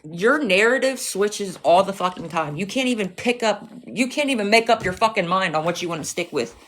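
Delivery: ordinary talk, nothing marked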